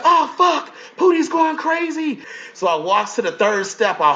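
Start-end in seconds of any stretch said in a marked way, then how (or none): 0.0-2.2 mock cast member alarm. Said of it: "So it's like".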